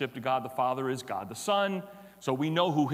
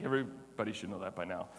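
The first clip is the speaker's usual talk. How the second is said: usual speaking voice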